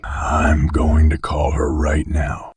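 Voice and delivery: slow, hushed and menacing; slow whispered threat; cold whisper. Retold or imitated. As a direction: breathe the threat, barely voiced.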